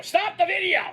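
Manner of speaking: high imitated angry order; pissed-off yell. Retelling a wrestler storming the production area, high angry yell. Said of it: "He's pissed".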